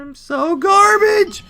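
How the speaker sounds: very high, loud, drawn-out self-scolding yell; real high-pitched yell of defeat; frustrated shout. Real, not acted. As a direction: wail it out slowly and loudly in frustration. Outburst at failing in the game.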